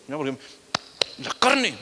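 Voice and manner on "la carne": much higher, louder jab; hammed-up macho taunt; imitated tough-guy jab; mock threat. Repeating the brother's threat.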